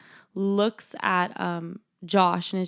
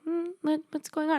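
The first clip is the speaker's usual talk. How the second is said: high, politely puzzled voice; imitated patience; 8 semitones higher, 3 dB quieter, faster than usual. Mom asks what is happening, patiently. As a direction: keep it high and restrained. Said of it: "she's very patient".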